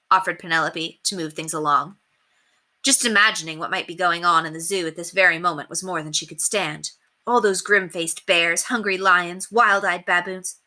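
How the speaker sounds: plain reading voice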